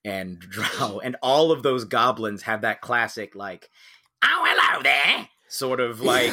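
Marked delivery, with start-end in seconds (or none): 4.2-5.3 high Cockney goblin greeting; evil chimney sweep voice. Retold or imitated. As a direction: sneer it like a little Cockney villain. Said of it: "evil little chimney sweep voice".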